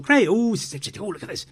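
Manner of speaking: lightly acting out thrilled fans; a half-hearted gush of delight; retold excitement. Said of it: "and we thought".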